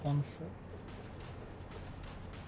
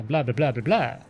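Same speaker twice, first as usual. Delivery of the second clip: put-on Transylvanian accent; 6 dB louder than usual. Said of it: "Which is why I also have this".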